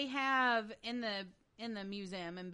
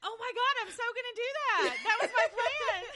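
excited; 8 dB louder, faster than usual. Real, not acted. Excited at request.

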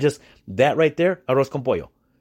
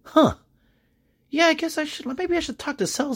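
much higher, dopey voice of someone just realizing; clueless shrug. Retold or imitated. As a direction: play them as dim and offhand. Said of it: "They're like".

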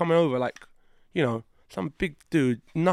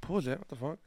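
mock offended; 12 dB quieter than usual. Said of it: "Treat me with some respect".

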